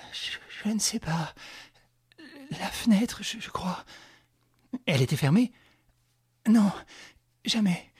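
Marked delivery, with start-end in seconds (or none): 0.1-3.8 breathless stammering whisper; out of breath whisper. Acted. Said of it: "Toute haletante encore". 6.5-7.9 out of breath whisper. Acted.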